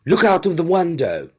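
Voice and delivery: high, loud urgent call; loud startled alert; alarmed warning. A narrator's reading. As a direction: sound the alarm sharply.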